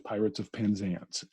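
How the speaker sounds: ordinary talk, nothing marked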